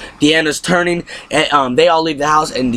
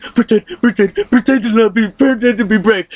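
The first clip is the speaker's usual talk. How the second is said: mock frantic mother; 7 semitones higher than usual. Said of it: "She's like".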